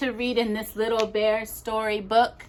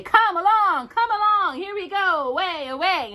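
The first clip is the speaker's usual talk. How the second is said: much higher singsong call; Vikings calling; 11 semitones higher, 4 dB louder than usual. Acted. Little Bear imagines Vikings calling him aboard, high singsong. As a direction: call it like jolly sailors.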